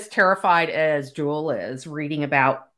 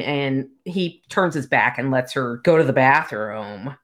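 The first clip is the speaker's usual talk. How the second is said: ordinary delivery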